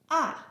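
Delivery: "Ah" falling sharply from high; fourth tone. Shown as a demonstration. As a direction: drop "Ah" fast from the top. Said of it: "which is a descending tone".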